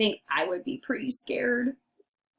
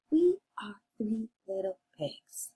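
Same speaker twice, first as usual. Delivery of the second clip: hushed read-along voice; whispered echo; 4 dB quieter, faster than usual. Shown as a demonstration. Quiet echo after your turn.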